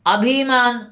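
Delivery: अभिमान with a long "ee", as "abheemaan"; mispronounced अभिमान. Shown as a demonstration. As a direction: say अभिमान as "a-BHEE-maan", high and loud. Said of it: "बड़ी ई की मात्रा नहीं".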